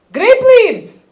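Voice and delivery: loud, high burst of excitement; excited mom. Retold or imitated. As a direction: mom spotting grape leaves. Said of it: "she looks over in the backyard".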